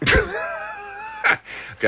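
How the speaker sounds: breaking into laughter; laughing. Real, not acted. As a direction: crack up mid-word. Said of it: "trying to say it with a straight face".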